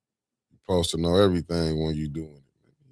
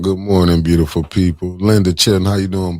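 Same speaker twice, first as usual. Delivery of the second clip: loud excited greeting; 9 dB louder than usual. Real, not acted. Greeting viewers by name on stream.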